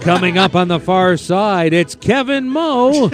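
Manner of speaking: high, stretched-out track-caller voice; hammed-up, slow-motion race call; horse race announcer. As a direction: draw out each call like racetrack commentary. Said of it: "You did the horse race".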